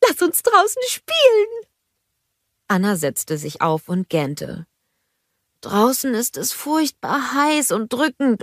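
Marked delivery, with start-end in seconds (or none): 0.1-1.7 very high, excited snowman plea; Olaf. A narrator's reading. Snowman begging princess to play, very high excited voice. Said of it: "rief er aufgeregt". 5.6-8.4 higher, mildly drowsy protest; sleepy reluctance. A narrator's reading. Princess just woken by snowman, mildly weary protest.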